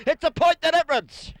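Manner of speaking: high, loud excited call of the margin. Real, not acted. Commentator calling the tight margin excitedly. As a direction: lift the voice on the close score. Rising tension, close game call.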